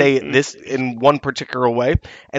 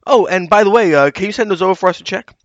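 higher, breezy put-on voice; mock PR person; 8 semitones higher, 5 dB louder than usual. Reporter mimics PR rep's last-minute ask, higher and breezy. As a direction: lightly mimic the casual PR rep.

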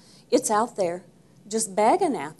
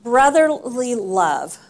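emphatic answer; 8 dB louder, slower than usual. Real, not acted. Answers his own question emphatically.

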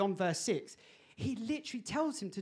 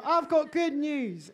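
mock proclamation; 7 semitones higher, 7 dB louder, slower than usual. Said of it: "Great joy".

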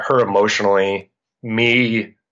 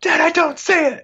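very high-pitched mock child's protest; imitated frustrated daughter. Daughter telling dad she can't spot it, frustrated. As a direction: mimic an exasperated kid, voice way up high.

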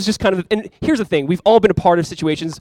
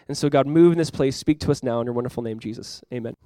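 prayer; 2 semitones lower than usual. Real, not acted. Pastor prays before sermon.

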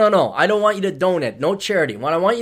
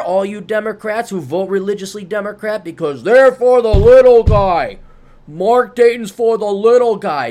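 higher, mocking, building to a loud "little guy"; sarcastic retort; 4 semitones higher, 5 dB louder than usual. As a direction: needle the Democrat buddy, then blast the punchline. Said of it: "And then you say".

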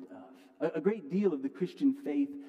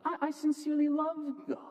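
raised-pitch earnest believer voice; believer's voice; 9 semitones higher than usual. Retold or imitated. Pastor voicing a believer who struggles to trust, high and earnest. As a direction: voice the sincere believer, lifted up. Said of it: "you can also be a believer".

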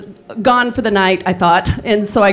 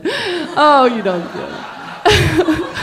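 laughing; 5 semitones higher, slower than usual. Real, not acted. Laughing aside.

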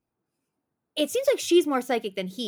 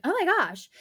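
shocked gasp; 8 semitones higher, 5 dB louder than usual. Retold or imitated. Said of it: "me and Cornelia were like".

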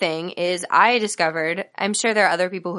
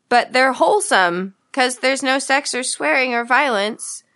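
a light, higher mock of a prim mother; imitating mom; 5 semitones higher than usual. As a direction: lightly voice a mother defending old films. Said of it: "according to my mom, they're like".

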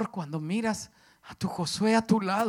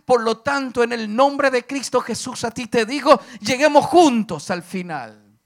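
full-force preaching; 6 semitones higher, 10 dB louder than usual. Acted. Preacher charging the congregation to finish together, impassioned and loud. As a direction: drive each phrase home like a preacher.

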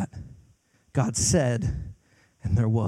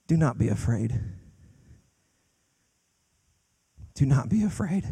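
soft reassurance; 3 semitones lower, 3 dB quieter than usual. Real, not acted. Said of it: "can I tell you today".

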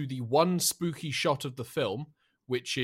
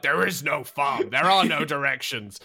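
put-on gruff old attendant; 4 dB louder than usual. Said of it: "and he's like".

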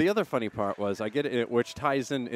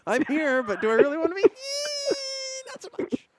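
laugh-crying. Acted. Mock-crying joke about himself.

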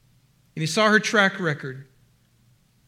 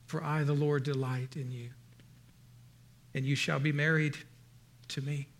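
soft, lower, intimate promises; tender; 3 semitones lower, 4 dB quieter than usual. Acted. God speaking to his bride, tender and hushed. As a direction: speak it gently as a bridegroom's vow. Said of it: "he spoke tenderly to her".

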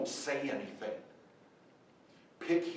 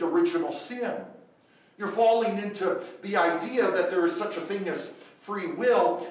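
full-force preaching; 5 semitones higher, 8 dB louder than usual. Acted. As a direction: drive each question home to the congregation. Preacher presses point loudly.

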